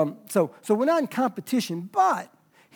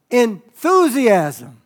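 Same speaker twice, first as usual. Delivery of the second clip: ENTHUSIASM drawn out slow and loud; emphatic; 3 semitones higher, 9 dB louder, much slower than usual. Real, not acted. Preacher drives home "Enthusiasm" to church, emphatic. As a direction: boom out "Enthusiasm", stretching it. Preacher hammers his key word.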